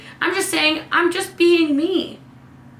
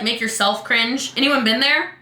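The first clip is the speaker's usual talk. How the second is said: ordinary delivery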